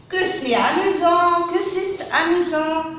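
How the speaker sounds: high, delighted exclaiming; exclamation. Shown as a demonstration. Teacher demonstrates an exclamative sentence, high delight. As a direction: sound amazed at the fun. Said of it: "phrase exclamative".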